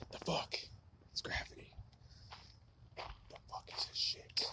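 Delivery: hushed whisper, almost no voice. Real, not acted. Musing alone about gravity, whispered. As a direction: breathe the question out in a whisper.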